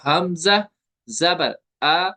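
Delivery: हमजा जबर आ slowly, the आ let go without a jerk; measured spelling with a medium-length आ; gentle Arabic reading. Shown as a demonstration. Teacher inviting students to try, slow gentle model. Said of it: "मैंने पढ़ा".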